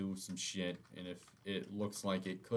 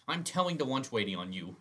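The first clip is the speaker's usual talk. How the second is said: put-on childish threat; 4 semitones higher, 5 dB louder than usual. Mock tattle, louder.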